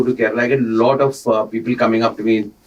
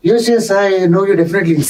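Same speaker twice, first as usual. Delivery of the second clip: high-pitched celebrity impression; imitated eager agreement, pitched well up; Sachin Tendulkar; 9 semitones higher than usual. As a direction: mimic him in a thin-pitched, high voice. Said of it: "Sachin is saying".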